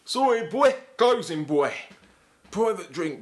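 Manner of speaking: loud, high mock bouncer; Lenny McLean. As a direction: turn them away like a comic bruiser. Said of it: "say he was a bouncer outside a nightclub".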